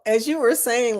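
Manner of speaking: ordinary talk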